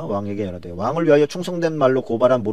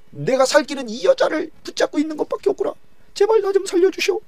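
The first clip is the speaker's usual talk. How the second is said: desperate pleading; 12 semitones higher than usual. Acted. Haman panics, begging for life.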